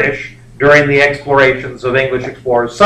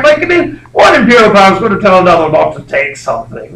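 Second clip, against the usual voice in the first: ordinary delivery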